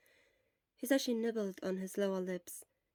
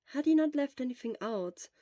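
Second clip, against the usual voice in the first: ordinary delivery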